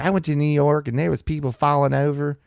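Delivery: high, whiny Southern drawl; mock whiny drawl. Comic mocking a politician, high whiny Southern drawl. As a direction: mimic her complaining about New York. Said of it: "with her little whiny drawl".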